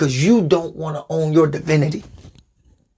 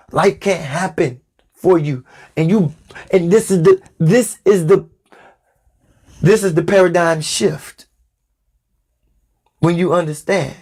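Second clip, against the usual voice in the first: normal talking voice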